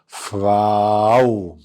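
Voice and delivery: فراو drawn out slowly as fraw; slow model pronunciation. Shown as a demonstration. Teacher telling the kids what to call the lady teacher, slow. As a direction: stretch فراو for the class. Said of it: "هنقول عليها إيه".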